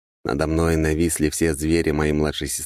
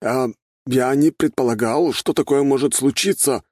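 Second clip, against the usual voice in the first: flustered excuse; 9 semitones higher than usual. A narrator's reading. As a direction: draw out a bewildered, higher-pitched excuse. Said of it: "растерянно пробормотал оборотень".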